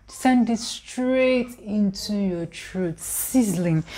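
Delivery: usual speaking voice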